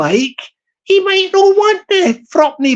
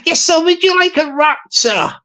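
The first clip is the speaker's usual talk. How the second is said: higher fawning shop assistant voice; eager service voice, pitched up; higher shop server; 6 semitones higher than usual. Retold or imitated. Shop server offering to wrap the hat, higher eager voice.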